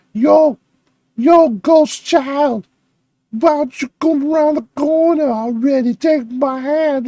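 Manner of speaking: loud mock Bronx-style call, slow; teasing impression of the ghost hunter; imitating her accent. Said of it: "It sounds like she has a strong accent".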